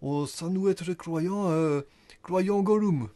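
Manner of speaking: high, halting mock Flemish accent. Nain flamand parle des croyants, faux accent flamand. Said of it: "C'est un nain flamand".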